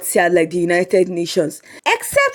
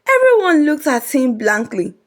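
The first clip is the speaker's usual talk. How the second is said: ordinary delivery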